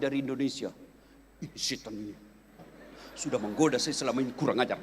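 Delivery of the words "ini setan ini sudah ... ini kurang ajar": low, quiet acted grumble; much lower and softer, pent-up annoyance; grumbling resentment. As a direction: mutter it darkly at an old enemy. Acted pilgrim grumbling at the devil.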